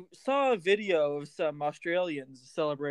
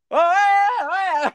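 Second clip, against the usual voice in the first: high, loud whoop of joy; celebrating like a fan; excited cheer; 14 semitones higher, 10 dB louder, slower than usual. Acted.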